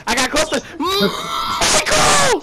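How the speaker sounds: high, drawn-out yell; excited shout. Real, not acted. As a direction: shout it out thrilled. Excited shout spotting player.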